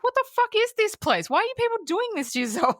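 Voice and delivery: incredulous mock outburst. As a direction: blurt it out like someone high who can't hold back. High Terry rants in the salon.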